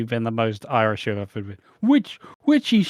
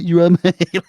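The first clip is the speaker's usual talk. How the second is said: loud, higher chummy call breaking into a laugh; loud mate greeting with a laugh; 7 semitones higher, 9 dB louder, much faster than usual. Retold or imitated. Retelling the neighbour's call from his car, laughing. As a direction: mimic the neighbour, laughing through it. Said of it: "pulled up in a car like".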